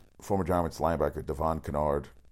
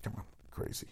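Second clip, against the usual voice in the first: whispered scoff; 4 semitones higher, 14 dB quieter than usual. Real, not acted. Scoffing at Giants moves.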